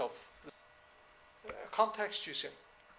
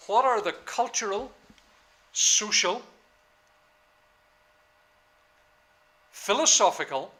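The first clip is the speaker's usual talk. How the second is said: spaced out, louder, for note-taking; slow dictation; 2 semitones higher, 11 dB louder than usual. Shown as a demonstration. Lecturer repeating a question slowly for students to copy. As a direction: dictate it slowly so they can write. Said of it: "Maybe that might be good to take down".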